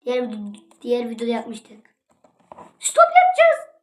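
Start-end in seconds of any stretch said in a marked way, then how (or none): 2.8-3.7 very high put-on voice; silly high-pitched announcing; squeaky countdown.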